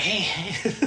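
high, timid mock greeting; awkward fan. Acts awkward fan greeting musician.

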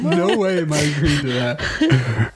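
slow, laughing refusal. Real, not acted.

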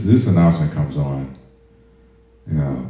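normal talking voice

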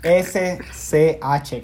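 letters spelled out one by one, slowly; slow spelling. Shown as a demonstration. Host spells the show's name out for his co-host, slowly letter by letter. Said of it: "Aquí en el podcast deletreando".

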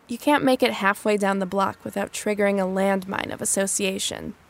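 ordinary talk

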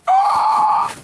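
sudden high yelp; loud high startled cry; startled shout. Retold or imitated. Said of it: "And I'm like".

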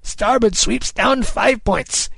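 slow, high, drawn-out command; Kidd crying orders. A narrator's reading. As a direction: cry the order out long across the deck. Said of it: "Kidd cried".

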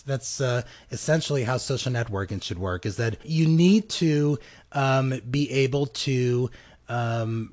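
normal talking voice

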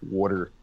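water with a Pennsylvania wooder vowel; water in a Pennsylvania accent. Shown as a demonstration. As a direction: say water the way he hears himself say it. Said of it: "I heard myself say".

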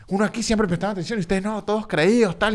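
mock-whining "always paid attention to you"; whiny fan voice. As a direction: parrot the fan's whiny grievance. Mocking the angry fan's complaint.

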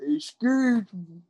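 high, drawn-out mock Baltimore accent. Imitating the dude who makes fun, mocking Baltimore accent. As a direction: slowly exaggerate a Baltimore accent. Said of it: "that one dude who does make fun of".

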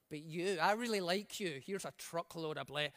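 playfully whisper-close favouritism; a preacher mocking a God who plays favourites, low and soft. Voicing a stingy God mockingly.

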